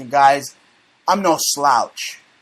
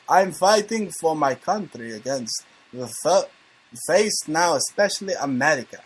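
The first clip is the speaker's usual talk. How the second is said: amateur mock Russian accent; 4 dB quieter than usual. Shown as a demonstration. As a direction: do a Russian that slips into Transylvanian. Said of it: "let me do it in a Russian accent".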